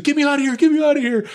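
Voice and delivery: high, loud frantic cry; mock panic. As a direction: imitate a friend freaking out in the water. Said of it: "now he's freaking out".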